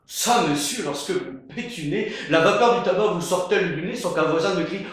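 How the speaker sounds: loud, fast and boisterous; truculent. Acted. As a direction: rattle it off, loud and hearty.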